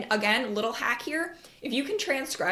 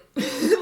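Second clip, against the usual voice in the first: short laugh; 5 semitones higher, 6 dB louder than usual. Real, not acted. Laughs at own joke.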